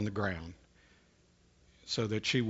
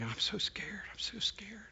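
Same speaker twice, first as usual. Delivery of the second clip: frightened breathless whisper, much softer; scared whisper; 8 semitones higher, 10 dB quieter than usual. Retold or imitated. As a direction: whisper it like a terrified child during a seizure. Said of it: "her voice started changing".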